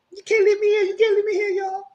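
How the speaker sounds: very high, hammed-up panicked begging; exaggerated high-pitched plea, tumbling out; mock frantic pleading. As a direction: beg in a squealing imitation of panic. Acting out Diana begging again.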